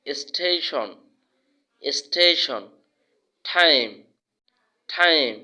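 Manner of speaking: "টাইম", "টেকনিক", "স্টেশন" with breathed th; aspirated T. Shown as a demonstration. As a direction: puff the t in "টাইম" and "স্টেবল". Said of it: "শুদ্ধ উচ্চারণ হবে".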